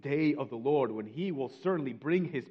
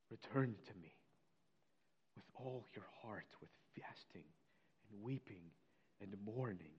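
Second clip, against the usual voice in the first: much softer, with long pauses between phrases; hushed, solemn; 3 semitones lower, 10 dB quieter than usual. Real, not acted. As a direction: read scripture very quietly, letting each phrase settle. Hushed repeat of the verse read earlier.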